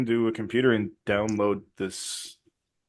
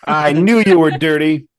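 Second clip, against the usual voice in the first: angry accusation; 4 semitones higher, 9 dB louder than usual. Acted. Character accuses traitor.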